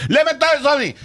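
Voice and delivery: high French Canadian accent; Quebec French accent, very high. Shown as a demonstration. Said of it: "This is my French Canadian accent".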